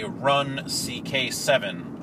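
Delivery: slow, spelled-out reading; reading slowly. Real, not acted. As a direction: read each letter slowly. Reading sticker slowly aloud.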